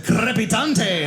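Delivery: slow Spanish flourish with rolled R; Spanish accent. Acted. Reading Spanish subtitles aloud, showy drawn-out Spanish accent. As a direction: roll the R in a hammed-up Spanish accent. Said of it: "I switched it over to Spanish subtitles".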